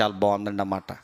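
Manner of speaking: normal talking voice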